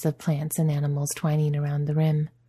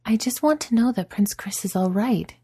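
higher worried princess voice; insist, raised and anxious; Katie insisting; 7 semitones higher than usual. A narrator's reading. Katie asks about Prince Chris, higher and insistent. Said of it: "she insists".

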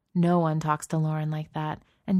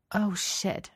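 higher, dismayed curse; 4 semitones higher than usual. A narrator's reading. Adrian spots the group nearby and curses in dismay. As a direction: curse as trouble dawns on you. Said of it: "she curses".

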